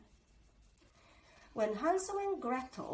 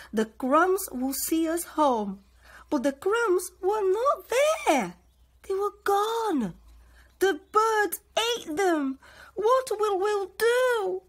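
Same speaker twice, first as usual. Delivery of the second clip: high, loud and anxious; higher and louder, slow and worried near the end; frightened children; 9 semitones higher, 10 dB louder than usual. A narrator's reading. Lost children in the forest, high and frightened.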